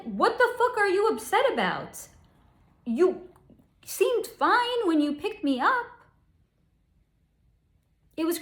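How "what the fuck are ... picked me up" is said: high, louder exasperated demand; exasperated outburst. Retold or imitated. [name] snaps back at boyfriend [name], high and louder, exasperated. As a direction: fire the question back at him, baffled and angry.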